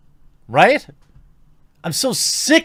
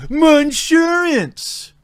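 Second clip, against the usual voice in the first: high, slow drawn-out mock wail; 12 semitones higher, much slower than usual. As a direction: wail it like a ruined owner. Mocking the owner's wail.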